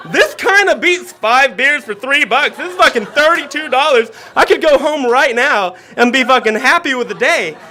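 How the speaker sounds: loud, laughing boast; laughing brag. Retold or imitated. Telling friends the deal beats beers, laughing loud. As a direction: crow about it through laughter.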